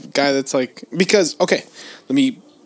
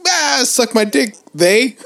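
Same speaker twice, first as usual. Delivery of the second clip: mock crew guy; 8 semitones higher, slower than usual. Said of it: "everybody's like".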